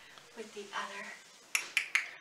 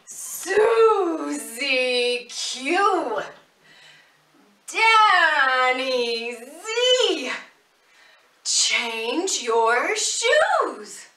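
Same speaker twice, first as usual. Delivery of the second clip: shouting call; 12 dB louder, much slower than usual. Pretend. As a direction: call them from across the house, drawn out. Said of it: "can you shout out with me?".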